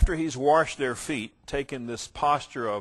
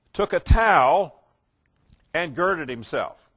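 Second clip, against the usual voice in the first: raised, measured Bible reading to the congregation; scripture reading; 4 semitones higher, 6 dB louder than usual. Acted. Preacher reading John to his listeners, solemn and raised. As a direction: proclaim the text with weight. Reads the foot-washing passage.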